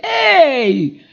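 loud brash compliment shouted across the street; street catcall. Retold or imitated. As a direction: call out like a rough mill-town guy. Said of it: "someone say".

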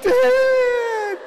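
high, loud wail for Dad; crying child's yell. Retold or imitated. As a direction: cry out like a scared little girl. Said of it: "Gabby's like".

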